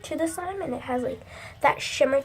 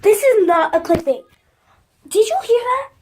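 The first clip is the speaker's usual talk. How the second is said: louder, higher and urgent; alarmed; 8 semitones higher, 8 dB louder than usual. Real, not acted. Girl calling to viewers that her slime fell in, loud alarm. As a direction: sound the alarm to your viewers.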